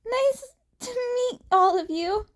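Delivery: high, rushed nervous blurt. A narrator's reading. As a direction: blurt it out awkwardly. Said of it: "Izuku blurted".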